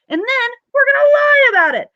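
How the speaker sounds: fake-cry the confession for laughs; mock sob. Mock wail over bad choices.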